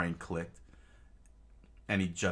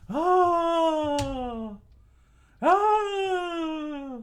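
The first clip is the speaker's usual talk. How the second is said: long, loud falsetto screams; high drawn-out battle scream; crowd scream; 9 dB louder than usual. Acted. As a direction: scream high like a charging mob. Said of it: "into the air and screamed".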